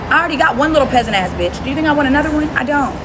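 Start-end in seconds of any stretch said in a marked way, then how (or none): none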